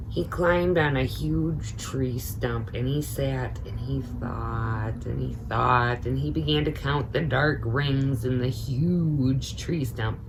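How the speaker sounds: usual speaking voice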